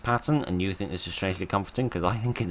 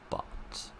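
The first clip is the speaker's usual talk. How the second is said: whispered; 11 dB quieter than usual. Real, not acted. Streamer whispering a cheeky word to viewers. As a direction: drop to a whisper on the rude word. Coy mid-sentence swear, whispered.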